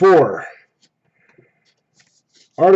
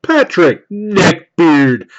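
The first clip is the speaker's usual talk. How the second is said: loud, drawn-out hype announcement; card reveal announcer; 4 semitones higher, 7 dB louder, slower than usual. Acted. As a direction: present each card like a prize. Announces pulled card like host.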